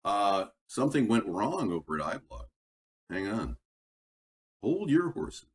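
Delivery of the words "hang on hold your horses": ordinary talk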